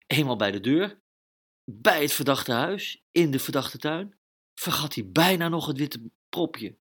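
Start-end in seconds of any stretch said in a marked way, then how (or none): none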